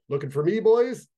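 put-on cocky, rising up high and loud on boys; bold quip. A narrator's reading. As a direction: toss it out like a dare. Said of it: "saying boldly".

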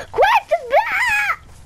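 very high, drawn-out urgent yell; frantic shout. Real, not acted. Gamer urging a teammate to build fast, frantic shout. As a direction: shriek it with the last word stretched. Frantic order during a game fight.

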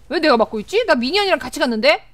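ordinary delivery

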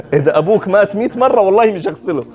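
put-on Egyptian accent. Egyptian brother refusing the family, in an Egyptian accent. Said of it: "in an Egyptian accent".

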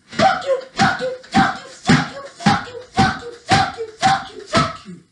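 very high-pitched, rapid-fire repeated jabs; play-fight rage in a tiny high voice; mock frenzied cursing. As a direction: squeal each one with every blow.

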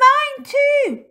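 put-on very high, loud little-child yell; shout. A narrator's reading. Lucy joining the food offering, high eager shout. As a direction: pipe up at full volume. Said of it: "shouted Lucy".

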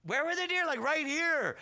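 high-pitched agitated back-and-forth; mock urgency. Buddy questions the pastor about the deer, mock urgency.

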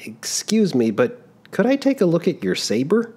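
put-on an embarrassed young man caught out; young traveler, flustered. Giovannino asks constable.

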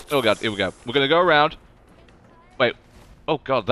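usual speaking voice

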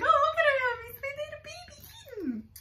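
put-on very high cooing baby voice, near tears; doting baby talk. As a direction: coo over him like a baby kitten, high and teary. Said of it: "I'm like".

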